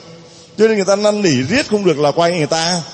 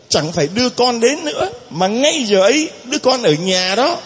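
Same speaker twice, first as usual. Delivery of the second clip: ordinary delivery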